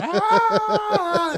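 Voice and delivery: high, piercing mock scream of pain; reenacted scream. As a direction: let out a short, sharp scream, as if the pain just hit. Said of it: "Like, I was screaming real bad".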